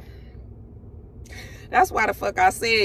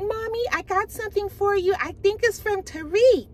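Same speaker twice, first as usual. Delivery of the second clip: put-on child voice; 9 semitones higher than usual. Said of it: "Olivia was like".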